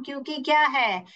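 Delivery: usual speaking voice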